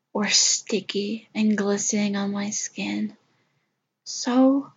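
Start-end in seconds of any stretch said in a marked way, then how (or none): 0.1-3.2 whispering. Acted. Intimate whisper.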